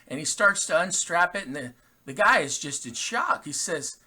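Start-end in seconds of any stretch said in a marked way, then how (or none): none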